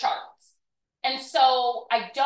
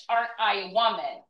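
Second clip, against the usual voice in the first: ordinary talk, nothing marked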